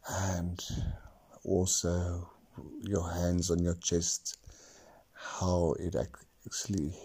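unhurried meditation guide; guided meditation. Acted. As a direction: talk them through each breath. Continues guided breathing.

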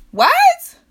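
high, loud startled what; squealed, outraged what; shocked disbelief. Real, not acted. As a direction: leap way up in pitch in disbelief. Shocked by the tech throwing up.

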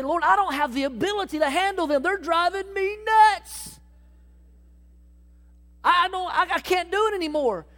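a worn-out believer at the end of his rope; exasperated complaint. Acted. Believer tells God people drive him nuts, exasperated.

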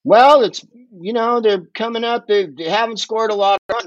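a mildly mocking announcer voice; mocking bland announcer. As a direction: parody a bland baseball announcer. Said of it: "drives me bloody mad to listen to".

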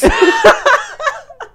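very high loud howl of laughter; shrieking laughter; huge laugh. Real, not acted. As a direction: crack up hard. Laughing at own story.

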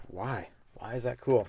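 mumbled bored reply; deadpan. Pretend. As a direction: say it like nobody cares. Mock bored partygoer reaction.

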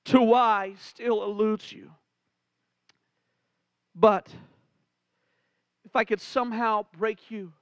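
ordinary delivery

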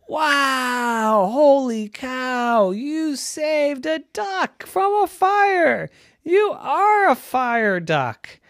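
high, loud, slow drawn-out amazement; amazed parents. A narrator's reading. Parents see Ducky saved a duck, exclaiming in amazement. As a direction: stretch it out in awed celebration.

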